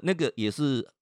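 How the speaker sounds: ordinary delivery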